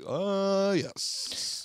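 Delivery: high, then a whispered "yes"; mocking the old teacher's yes. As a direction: mimic the teacher's catchphrase. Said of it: "Does he still talk like that".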